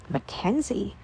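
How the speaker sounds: plain reading voice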